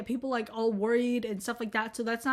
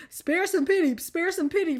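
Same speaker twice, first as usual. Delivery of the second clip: mock plea; 6 semitones higher, 4 dB louder than usual. Shown as a demonstration. Redoing phrase in mock plea.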